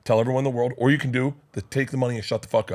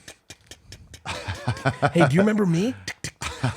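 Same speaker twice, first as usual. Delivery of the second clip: creepy ticking; 6 semitones higher than usual. Pretend. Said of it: "wake him up just going".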